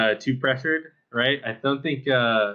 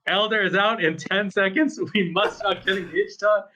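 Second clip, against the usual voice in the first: Eric yelling; 8 semitones higher than usual. Retold or imitated. Player imitating a teammate's countdown warning, mock yelling. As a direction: imitate a teammate's urgent yell without full volume. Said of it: "Eric, is yelling, like".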